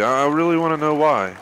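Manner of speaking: loud, high frustrated yell; angry outburst. Real, not acted. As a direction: shout at the game in disbelief. Yelling at the game.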